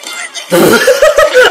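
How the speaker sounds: a high burst of laughter. Real, not acted. Viewer laughing at Chuck stuck, high laugh. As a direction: crack up high.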